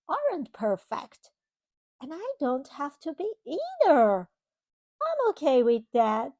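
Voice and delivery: put-on storybook reading to a child; reading to children. Parent reading a storybook to a child, gently. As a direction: read it like a bedtime picture book. Reading picture book aloud.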